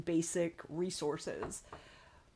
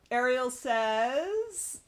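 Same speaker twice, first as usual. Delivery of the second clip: ordinary delivery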